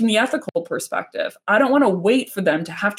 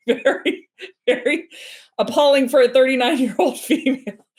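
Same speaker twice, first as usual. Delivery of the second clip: laughing; 5 semitones higher than usual. Real, not acted. Laughing at her own nerdiness.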